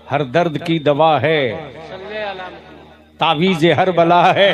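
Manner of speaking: हर दर्द की दवा है shouted with दवा hit hard; loud. Shown as a demonstration. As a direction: call it out loud to the crowd. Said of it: "जोर से".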